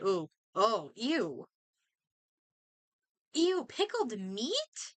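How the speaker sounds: ordinary talk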